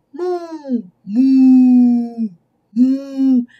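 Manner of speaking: drawn-out cow moo. Acted. Cow moos in story.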